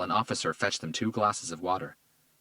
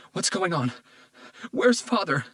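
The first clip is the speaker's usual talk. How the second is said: high, anxious, cracking voice; panicked daughter calling for her father; croaking with fear; 11 semitones higher, 5 dB louder than usual. A narrator's reading. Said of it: "Her voice croaked with fear and anxiety".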